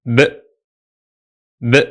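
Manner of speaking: a lone voiced "b"; isolated b sound. Shown as a demonstration. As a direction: say "b" alone, twice. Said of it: "which sounds like".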